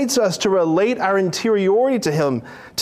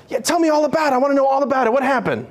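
much higher, delighted asking; eager father; 8 semitones higher, much faster than usual. Acted. Father welcoming son's story, eager and much higher. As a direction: welcome every word. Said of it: "You see the difference".

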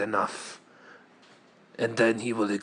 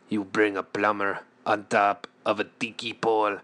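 ordinary delivery

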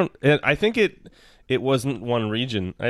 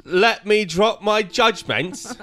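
put-on terrible British accent; 8 semitones higher, 3 dB louder than usual. Reading one more card in the accent, high and hammily British. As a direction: do a bad Mary Poppins-era British voice. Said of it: "in the accent".

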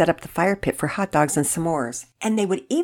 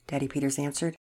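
usual speaking voice

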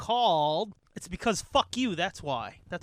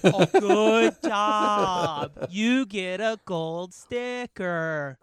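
slow, sugary mock praise; patronizing praise; 5 dB louder, much slower than usual. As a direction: praise them like a toddler.

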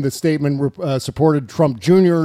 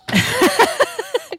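high burst of laughter. Real, not acted. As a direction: laugh high after the rant. Laughing at own rant.

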